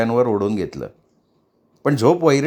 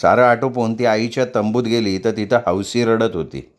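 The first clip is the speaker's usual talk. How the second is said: ordinary delivery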